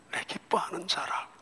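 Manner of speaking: soft unvoiced whisper; God whispering to his son. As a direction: breathe it tenderly. Whispered God's words.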